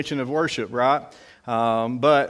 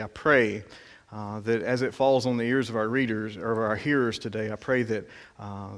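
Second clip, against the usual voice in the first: softer, subdued praying voice; prayer; 3 semitones lower, 5 dB quieter than usual. Real, not acted. Pastor asking God to bless the reading, subdued prayer. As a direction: pray quietly, dropping away at phrase ends.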